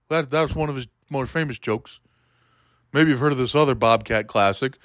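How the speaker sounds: ordinary delivery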